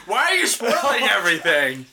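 high, whining complaint through a laugh; laughing, high-pitched exasperation; exasperated protest. Real, not acted. Protesting the spoilers.

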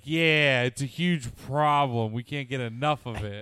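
loud eager chef voice; loud mock chef. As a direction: boom it out as the chef. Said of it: "he's like".

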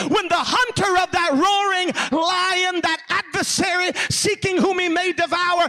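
high, loud, driving revival preaching; full-force preaching. Real, not acted. Preacher rallying the knocked-down against the devil, shouted preaching. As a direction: build it into a defiant, shouted proclamation.

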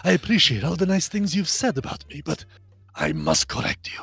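put-on smooth, suave Spanish-accented voice; Antonio Banderas. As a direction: play the polite, suave Latin gentleman, gently correcting. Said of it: "Antonio Banderas voice".

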